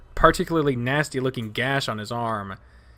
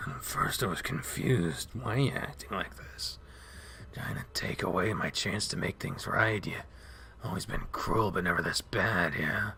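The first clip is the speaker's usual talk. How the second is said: low, soft, drowsy mumble; mumbling; 4 semitones lower, 8 dB quieter than usual. Acted. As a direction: mumble it to yourself. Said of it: "beginning to mumble".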